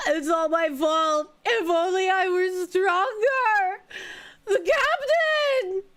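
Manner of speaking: anguished, slow sobbing yells; crying shout. Acted. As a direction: wail it out in grief. Voices distraught game character.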